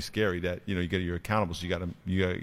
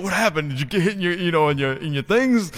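higher, louder worried coach; concerned coach; 8 semitones higher, 5 dB louder than usual. Retold or imitated. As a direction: check on the kid with alarm. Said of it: "I said".

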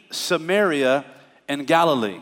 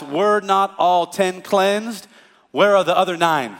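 slow question; 2 semitones higher, 3 dB louder, slower than usual. Retold or imitated. Jesus asking slowly.

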